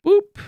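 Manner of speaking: playful high boop. Pretend. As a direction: pop out a cute high boop. Playful sound while sending.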